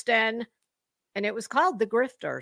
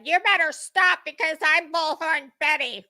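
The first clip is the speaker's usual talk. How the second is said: higher, louder mock threat from a self-important woman; mocking her boast; 6 semitones higher, 4 dB louder than usual. Mocking the woman's boast to the one who called her out, high and snide. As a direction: sneer it back as her bragging threat. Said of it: "when she said".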